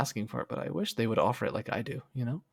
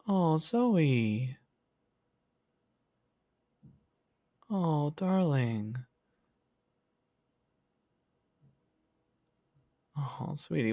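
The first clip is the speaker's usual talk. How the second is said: higher, slow soothing to a friend who's not okay; tender comfort; 4 semitones higher, much slower than usual. Real, not acted. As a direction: console gently like a caring friend. Comforting a sad chat friend.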